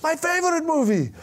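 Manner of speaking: put-on high, thrilled kid voice; excited young fan. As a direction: gush over the favourite film, pitched high. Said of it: "then Juan Cruz said".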